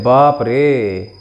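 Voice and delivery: बाप रे with the रे held long and falling; a long-drawn बाप रे; drawn-out exclamation. Shown as a demonstration. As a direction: stretch the रे of बाप रे like a cry of shock. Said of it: "तो रे जो लंबा निकला".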